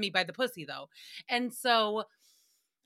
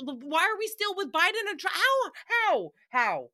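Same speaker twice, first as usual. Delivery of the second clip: exasperated outburst; 8 semitones higher than usual. Real, not acted. Host ranting about the same two candidates, exasperated. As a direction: cry out "how" in high disbelief, dropping on the last.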